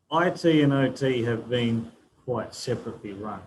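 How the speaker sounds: ordinary talk, nothing marked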